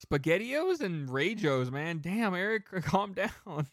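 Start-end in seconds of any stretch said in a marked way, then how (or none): none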